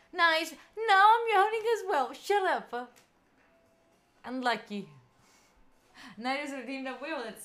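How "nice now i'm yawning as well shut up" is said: loud, excited and high; loud excited outburst. Real, not acted. Loud burst.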